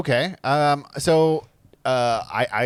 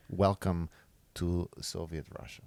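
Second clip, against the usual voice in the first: low, soft Russian accent demo; 6 semitones lower, 10 dB quieter than usual. Shown as a demonstration. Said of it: "an example of a Russian accent".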